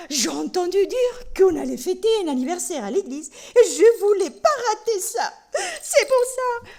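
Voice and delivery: high, loud little puppet answer. Acted. Puppet Tolo answers the pastor, high and loud. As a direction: answer brightly as the teddy bear. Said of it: "Ça, c'est Tolo".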